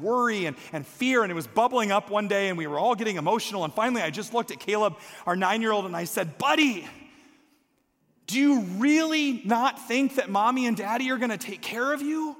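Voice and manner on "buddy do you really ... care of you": put-on high, louder plea to a child; pleading in frustration with your kid; exasperated. Mother pleading with her nine-year-old, exasperated. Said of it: "in this exasperated moment".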